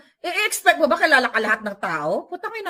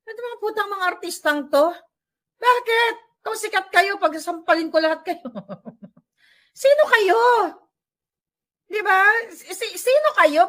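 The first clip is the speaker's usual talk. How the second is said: higher and louder scolding, near yelling; angry rant; 8 semitones higher, 3 dB louder than usual. Real, not acted. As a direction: shout down the snobbish stars. Ranting at arrogant celebrities.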